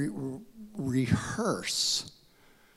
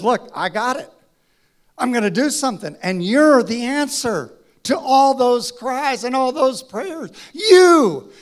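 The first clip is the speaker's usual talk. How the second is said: louder, higher, fast and insistent; God declaring Moses is the one; emphatic insistence; 8 semitones higher, 10 dB louder than usual. Acted. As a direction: drive it home to the final You.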